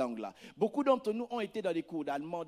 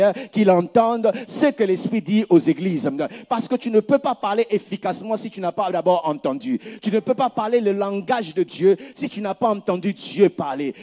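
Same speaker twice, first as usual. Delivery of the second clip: loud, driving sermon climax; full-force preaching; 11 dB louder than usual. Acted. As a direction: preach it hard to the congregation. (prédication à pleine voix, martelée)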